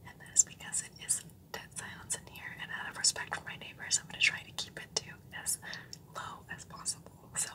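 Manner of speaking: hushed, voiceless whisper out of respect for neighbors; real whisper, kept as low as possible. Real, not acted. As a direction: whisper a tour without waking anyone. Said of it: "I am whispering".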